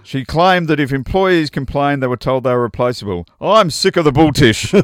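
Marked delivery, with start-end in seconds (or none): 3.4-4.7 loud, raised quote of a fed-up worker; a touch of a rant; half-laughing mock rant; mock outburst. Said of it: "she added".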